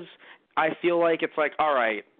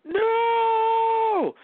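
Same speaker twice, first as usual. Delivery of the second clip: very high, louder anguished cry; 4 dB louder than usual. Retold or imitated. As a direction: wail it out as if your home burned down. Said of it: "you bet your ass I'd be like".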